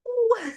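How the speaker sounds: a sudden high tickle-cry in the throat; a little pained squeal; high squeaky ooh. Pretend. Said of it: "Sometimes it tickles and goes".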